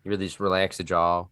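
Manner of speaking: low, relaxed-jaw Southern accent; mock your family's Texas accent, pitched in a low middle range. Shown as a demonstration. Streamer showing co-host his family's accent, Southern accent. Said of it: "making fun of my family members' kinda southern accent".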